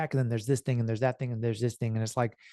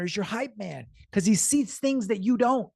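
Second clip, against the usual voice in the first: normal talking voice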